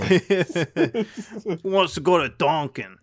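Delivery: slow, drawn-out mock Boston; Boston accent. Friend rallies pals for Dunkin', playful Boston accent. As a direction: stretch it out in a joke Boston accent. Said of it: "can you do a Boston accent".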